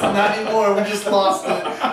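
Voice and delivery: loud laughing through the words; cracking up mid-sentence. Real, not acted. Laughing fit on air.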